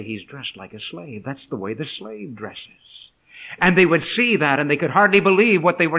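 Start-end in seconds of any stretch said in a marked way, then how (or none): 0.0-1.2 softly murmured aside; hushed remark. Acted. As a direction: drop to a low-key, soft voice. Disciples' hushed remark on seeing him.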